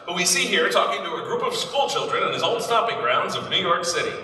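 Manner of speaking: put-on brisk old newsreel narration; peppy vintage announcer read. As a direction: sell it like a 1930s newsreel.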